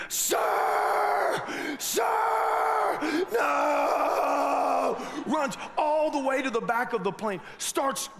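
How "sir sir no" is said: high frantic shouts; screaming steward. Retold or imitated. Steward screaming at a passenger in the bathroom, frantic high shout. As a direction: yell it like a panicked flight attendant.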